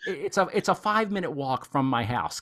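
high, loud, playful exasperation; worked-up comic frustration; exasperated. Real, not acted. Mock exasperation at a nearby missed show.

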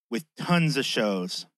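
ordinary delivery

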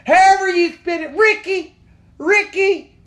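high, loud Southern mom hollering; yelling mom. Retold or imitated. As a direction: holler at your kid in a hammed-up Southern voice. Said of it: "she starts yelling at the son".

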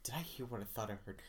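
soft, quick self-questioning; retold quiet disbelief; hushed inner thought. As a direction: mutter it to yourself in the dark. Retold frightened thought in bed.

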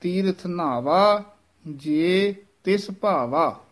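slow ਨਾਵਾ, ਜੇ set apart from ਤਿਸ; ਜੇ, pause, ਤਿਸ ਭਾਵਾ, slowly; slow and careful, ਜੇ apart; separated ਜੇ, heavy ਨਾਵਾ. Shown as a demonstration. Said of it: "ਜੇ ਨੂੰ ਨਖੇਰ ਕੇ ਪੜ੍ਹਨਾ ਹੈ".

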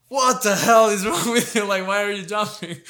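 mock yell of a coach, a laugh breaking through; exasperated coach. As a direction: play the baffled coach calling out a beginner's bad habit. Coach scolds his jumping punches.

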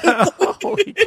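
high, laughing through the words; can barely talk for laughing; laughing hard. Real, not acted. Hosts cracking up at the clothes-throwing story, high laughing.

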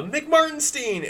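high, slow call of the name. Acted. Hatchet Jones calls to the doctor over the wind, high and slow. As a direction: call his name across the storm.

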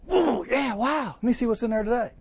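like tearing open Grandma's letter; excited. Retold or imitated. Preacher imitating an eager reader opening Grandma's letter, delighted.

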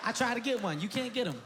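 normal talking voice

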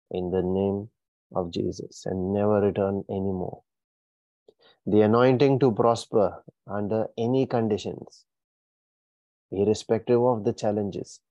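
ordinary talk, nothing marked

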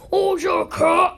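high, loud excited cry; excited squeal. Retold or imitated. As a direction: gush at the cat in a shout. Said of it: "I'm like".

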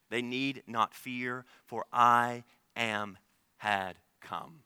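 drawn-out, slow, weighty proclamation; slow solemn declaration. Real, not acted. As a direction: let each word land slowly. Preacher slows to declare.

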